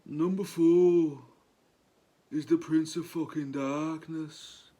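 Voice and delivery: slow Brummie rock-star impression; Birmingham accent. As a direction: put on a hammed-up Birmingham accent, slowly. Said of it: "That's me best Birmingham accent".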